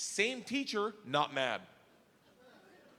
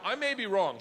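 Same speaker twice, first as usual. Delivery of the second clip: ordinary talk, nothing marked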